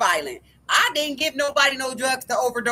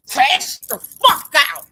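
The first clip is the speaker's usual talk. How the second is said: slow, drawn-out, louder and higher; hammering home the point to viewers; emphatic yell; 4 semitones higher, 7 dB louder, much slower than usual. Real, not acted. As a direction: stretch each word and hit it hard. Ranting, emphatic climax.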